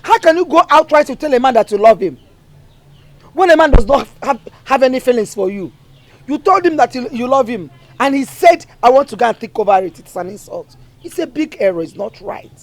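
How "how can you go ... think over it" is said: louder, insistent mother's reproach; stern scolding. Acted. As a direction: scold her daughter, voice raised.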